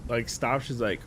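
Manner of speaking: normal talking voice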